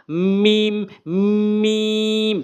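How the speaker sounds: মিম with a long held, level ee; drawn-out meem. Shown as a demonstration. Teacher shows learners the letter, long sustained মিম. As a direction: stretch each মিম and close on the lips. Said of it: "টেনে বল-, উচ্চারণ করবেন".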